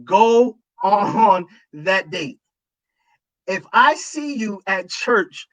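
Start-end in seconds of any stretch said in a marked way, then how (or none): 0.0-2.4 "Go on that date" drawn out word by word; slow, emphatic repeat. Real, not acted. Pastor telling a churchgoing wife to honor date night, slow and emphatic. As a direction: stretch "Go... on... that date" at half speed.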